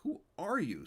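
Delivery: put-on startled, high stammering boy; frightened child's stammer. A narrator's reading. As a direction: blurt it out in a fright. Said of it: "Stanley stammered".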